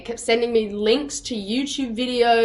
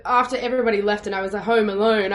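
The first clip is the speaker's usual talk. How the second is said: ordinary talk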